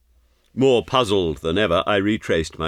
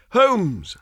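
high-pitched cry of amazement; sudden high, amazed exclamation; astonished cry; 13 semitones higher than usual. A narrator's reading. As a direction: cry out to a friend believed dead. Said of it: "I cried".

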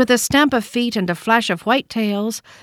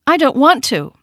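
higher, louder stubborn refusal; little rabbit refusing; 7 semitones higher, 6 dB louder than usual. A narrator's reading. Toy rabbit refusing the wild rabbit, higher and louder. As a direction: dig in your heels, pitched up. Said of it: "he said again".

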